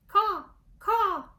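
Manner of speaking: high, loud hoarse caw; loud, high cracked bird call; cracked crow caw. Acted. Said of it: "his voice became cracked and hoarse".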